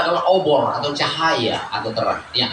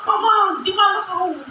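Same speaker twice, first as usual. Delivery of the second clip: put-on child crying out for parents; 11 semitones higher than usual. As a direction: call out like a lost child looking for mum and dad. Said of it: "anak-anak sapa".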